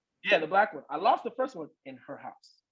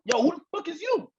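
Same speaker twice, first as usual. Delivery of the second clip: higher, confronting demand; imitating an aggressive challenge; 11 semitones higher than usual. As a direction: get in his face. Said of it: "It's like".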